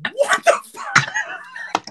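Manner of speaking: shocked outburst. Retold or imitated. Shocked reaction in retold story.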